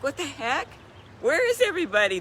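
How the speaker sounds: high, startled outburst; retold disbelief. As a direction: cry out in disbelief at the empty lawn. Retelling herself alone on lawn.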